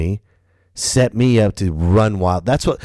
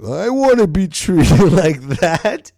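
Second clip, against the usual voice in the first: high, slow whiny longing, laughing; jealous other branches; 10 semitones higher, 4 dB louder than usual. Retold or imitated. Other branches envying the Air Force, high whiny and slow. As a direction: whine it like a jealous soldier. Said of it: "we're like".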